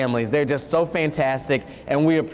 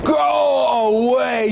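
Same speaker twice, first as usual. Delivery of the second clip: slow, louder grumpy elephant growl; 6 semitones higher, 4 dB louder, much slower than usual. Acted. Elephant telling the doorbell visitor to leave, grumpy and slow. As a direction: push the visitor off, slowly. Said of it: "he said".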